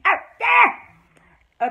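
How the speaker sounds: high, loud, drawn-out outburst; worked-up holler; exasperated shout. Real, not acted. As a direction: end the list in a stretched yell. Climax of list of villain's aliases.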